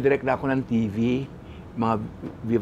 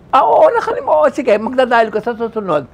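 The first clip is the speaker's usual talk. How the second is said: mock surprise; 9 semitones higher, 10 dB louder than usual. Director brushing him off.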